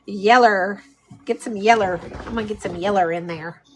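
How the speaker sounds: yeller said as YEL-ler, the ending an r sound; East Texas yeller. Real, not acted. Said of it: "We say yeller all the time".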